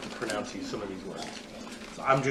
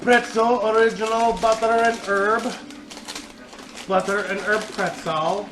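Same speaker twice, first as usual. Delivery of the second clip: higher, louder announcer product call; 8 semitones higher, 3 dB louder than usual. Acted. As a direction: announce the snack like a TV host. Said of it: "I keep rolling into T V announcer dude".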